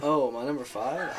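usual speaking voice

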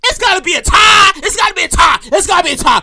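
high, shouted amazement with stretched words; hyped. Retold or imitated. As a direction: yell it like a blown-away fan, easing off on the repeats. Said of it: "I was hyped".

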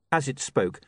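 usual speaking voice

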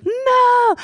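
very high, long drawn-out refusal; high sustained mock wail; imitated refusal. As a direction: imitate a possessed girl wailing in refusal. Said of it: "the whole time she goes".

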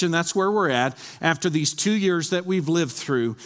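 ordinary talk

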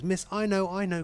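high, excited plea to be picked; eager pupil. Acted. Little Johnny begs the teacher to pick him, eager. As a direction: call out high, bursting with the answer.

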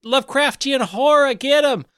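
high, loud shout to redirect attention; screamed alarm. Acted. Player screaming out to turn the crew on someone else, high loud yell. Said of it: "scream out".

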